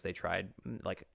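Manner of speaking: ordinary delivery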